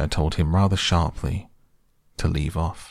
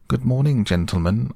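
higher-pitched, polite greeting in character; very respectful; 6 semitones higher, 3 dB louder than usual. Retold or imitated. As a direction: greet the sentries with put-on deference. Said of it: "very respectfully".

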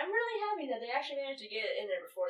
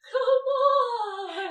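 high loud scared whine; whiny voice; 13 semitones higher, 9 dB louder than usual. Retold or imitated. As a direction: whine it, terrified. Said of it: "whiny voice just going".

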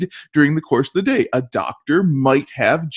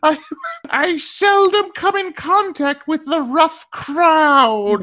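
high, slow, precious squeak; high squeaky voice; 9 semitones higher, slower than usual. Pretend. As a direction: draw it out slow and fussy, pitched up. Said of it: "Give it to us in your best voice".

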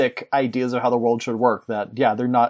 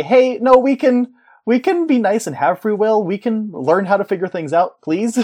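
higher, earnest plea to both sides; high pleading; 7 semitones higher than usual. Acted. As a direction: beg them to just get along.

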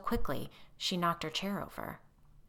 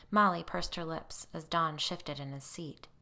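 ordinary delivery, nothing marked

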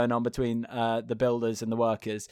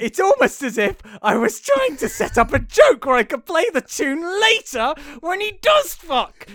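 high, loud, half-laughing protest; complaining to his co-host about the spoiled joke, high and loud; exasperated high complaint; 9 dB louder than usual. Real, not acted. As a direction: whine that the joke is ruined.

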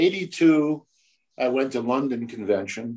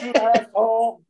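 mock insult; 10 semitones higher, 3 dB louder than usual. Prank caller voiced on phone.

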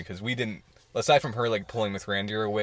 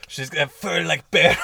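put-on Russian accent; 6 semitones higher, 6 dB louder, slower than usual. Russian stripper bit continues.

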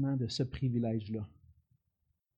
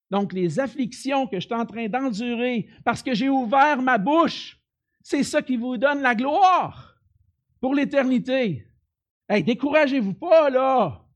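preaching at full force; 7 semitones higher, 6 dB louder than usual. Real, not acted. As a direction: preach it with fire.

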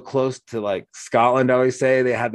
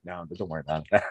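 mock reassurance; 6 semitones lower, 9 dB quieter, much faster than usual. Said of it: "And I'm like".

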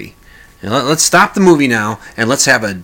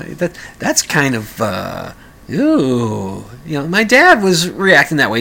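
ordinary talk

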